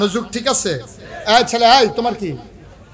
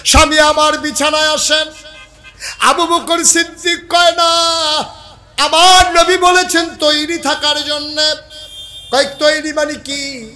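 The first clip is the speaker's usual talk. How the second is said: loud, high-pitched sermon cry; high, ringing preacher's shout; full-force preaching; 10 semitones higher, 9 dB louder than usual. Acted. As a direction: belt out the dialogue at the top of the voice.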